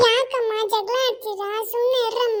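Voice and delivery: put-on a child stepping into the parents' quarrel; child pleading. Child begs parents to stop.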